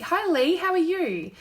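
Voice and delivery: usual speaking voice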